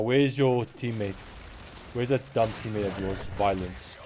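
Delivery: normal talking voice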